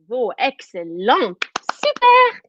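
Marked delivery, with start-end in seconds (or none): 1.7-2.4 high, squealed praise; excited cheer. Real, not acted. Teacher praising children's shouting, excited cheer. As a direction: burst out with delighted high-pitched approval.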